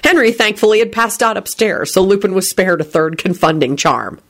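ordinary delivery, nothing marked

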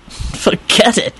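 slow, breezy refusal; cheerful refusal. Acted. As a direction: turn them down cheerfully, drawing it out. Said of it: "Lupin replied cheerfully".

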